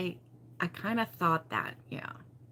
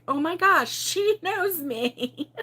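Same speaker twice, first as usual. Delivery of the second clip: high, slow words broken by laughter; laughing; 9 semitones higher, much slower than usual. Real, not acted. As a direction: laugh through the line in delight. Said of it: "laughing at being imitated".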